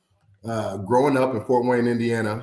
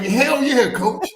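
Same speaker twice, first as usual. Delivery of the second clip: far higher and louder, bursting with joy; thrilled yell; 14 semitones higher, 4 dB louder, much slower than usual. Retold or imitated. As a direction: let it explode like the dream came true. Accepting the draft call, thrilled.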